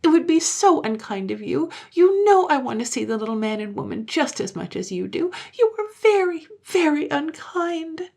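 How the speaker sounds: high, tearful reproach; acted little-girl upset; sobbing child. A narrator's reading. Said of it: "And she sobbed bitterly".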